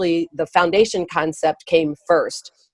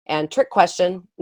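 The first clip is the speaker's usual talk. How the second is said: ordinary delivery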